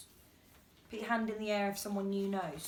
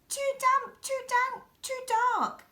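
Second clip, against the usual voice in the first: high, squeaky little mouse voice; small mouse squeaking complaints about the damp cave; 13 semitones higher than usual. Acted. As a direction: squeak it out like a tiny fussy mouse. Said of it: "Mouse squeaks".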